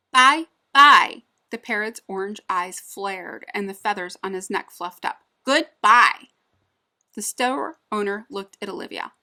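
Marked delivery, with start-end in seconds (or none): 0.1-1.2 high, loud squawking parrot. Acted. Parrot talks to the man. 5.4-6.3 high, loud squawking parrot. Acted. Parrot talks again.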